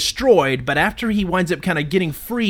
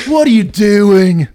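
slow, loud, higher panicked yell; Bill Paxton impression; 4 semitones higher, 9 dB louder, slower than usual. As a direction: yell it slowly in alarm. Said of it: "That's my Bill Paxton".

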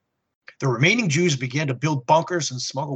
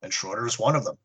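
ordinary delivery

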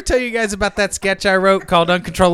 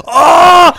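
loud, high, drawn-out wail; cartoonish involuntary howl; sudden cry mid-sentence; 11 semitones higher, 10 dB louder than usual. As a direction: burst out with a long "ohh". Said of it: "he'll just go".

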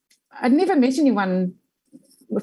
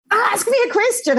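imitated yell; 13 semitones higher than usual. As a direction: bark the demand up high, imitating her. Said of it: "she starts yelling at me".

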